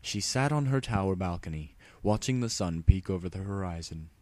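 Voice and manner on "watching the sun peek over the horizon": usual speaking voice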